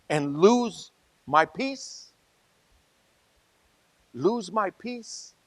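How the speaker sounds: drawn out slowly, rising at the end; slow, searching question. Real, not acted. Preacher asking the congregation if a win is worth it, drawn out slowly. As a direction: stretch each word out as a question. Preacher slows on the key phrase.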